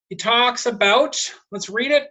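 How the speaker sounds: ordinary delivery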